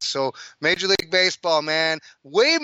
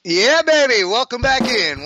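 excited; 8 semitones higher, 7 dB louder than usual. Real, not acted. Host greeting returning listeners.

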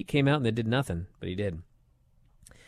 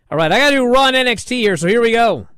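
fast, much higher and louder, racing into the recap; hyped rush; 8 semitones higher, 7 dB louder, faster than usual. Real, not acted. Host launching into the Raw and NXT recap, hyped and fast. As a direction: fire it off like a hyped host kicking off the rundown.

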